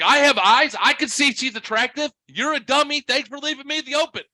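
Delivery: loud, high-pitched mock arguing; retold heated comeback. Retelling his argument in the aisle.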